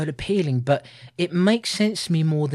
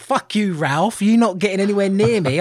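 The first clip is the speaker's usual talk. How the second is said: louder, higher acted rebuff; mock defiance; 5 semitones higher, 6 dB louder than usual. Co-host voices Donna rejecting Ralph, mock defiance. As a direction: tell him off, hammed-up.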